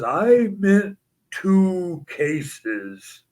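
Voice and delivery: very slow, deadpan big-man voice; Andre's slow voice. Retold or imitated. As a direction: stretch every word like a calm giant.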